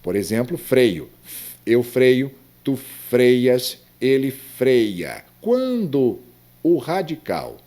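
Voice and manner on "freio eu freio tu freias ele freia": "freio", "freias", "freia" punched loud on FREI; "freia" slow, strong first syllable with clear i glide; stress on the root FREI. Shown as a demonstration. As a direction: recite the forms hitting FREI in "freio". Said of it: "Quando nós temos o radical forte".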